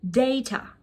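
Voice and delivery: "data" said as DAY-tuh; British pronunciation. Shown as a demonstration. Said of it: "Try to repeat after me".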